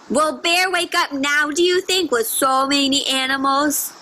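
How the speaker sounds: usual speaking voice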